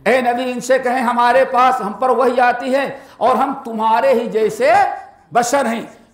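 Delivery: ordinary delivery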